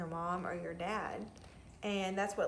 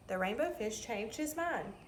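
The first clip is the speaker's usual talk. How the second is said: ordinary delivery, nothing marked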